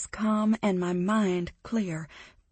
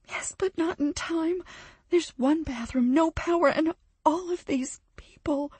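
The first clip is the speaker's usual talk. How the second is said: voice breaking; 9 semitones higher than usual. Acted. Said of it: "Her voice has dropped again and breaks".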